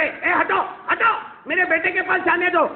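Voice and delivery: high, pushy mother shoving through a crowd; insistent old mother calling out; mother's voice. Acted. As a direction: push through, demanding to reach her son.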